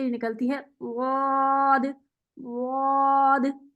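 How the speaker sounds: वाद with the tongue sides pressed up against the back teeth, drawn out slowly; Arabic dhad sound. Shown as a demonstration. Teacher demonstrating to her student the dhad sound, slow and drawn out. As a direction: draw out वाद slowly, tongue edges pressed to the upper molars. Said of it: "इसकी आवाज़ थोड़ी different सी होती है".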